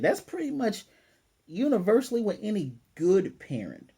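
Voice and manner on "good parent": "good" hit harder than the rest; stress on GOOD. Real, not acted. Explaining what parents would do, heavy emphasis on "good". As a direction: lean hard on the word "good". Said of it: "I'm gonna emphasize good".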